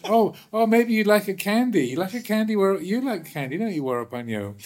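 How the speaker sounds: hammed-up syrupy bribe; mock sweet offer. Teacher mock-offering candy.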